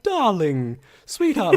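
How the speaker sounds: lightly hammed-up posh British endearments, rising high; mock British accent. Mocking lazy TV casting, in a mock British accent. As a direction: send up period drama actors with a mock British accent. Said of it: "They're all talking like".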